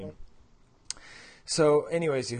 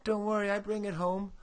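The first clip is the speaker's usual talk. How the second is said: higher, lightly voiced sweet-talker; mock cheating spouse; 7 semitones higher than usual. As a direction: tease him like a fickle lover. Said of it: "And then what do you say?".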